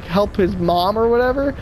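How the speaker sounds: ordinary talk, nothing marked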